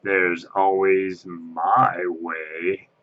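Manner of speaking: very slow, eerie host; Ghost Host voice. Retold or imitated. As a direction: drag it out with ghostly menace. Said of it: "did the voice where you're in the stretching room".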